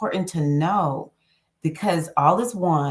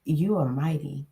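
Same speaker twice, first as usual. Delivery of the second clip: normal talking voice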